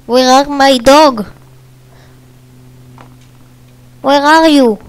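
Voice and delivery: loud, high, slow calling out; calling for dog. Real, not acted. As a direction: call out across the field for the lost dog.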